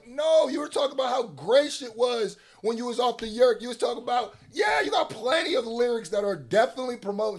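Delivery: high, insistent calling-out; heated rebuttal. Real, not acted. Arguing with the rapper.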